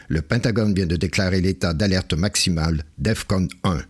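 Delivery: voix de lecteur de nouvelles, plus grave; chef d'antenne grave. Acted. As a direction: lis l'alerte comme un chef d'antenne sérieux. (chef d'antenne grave annonçant la guerre)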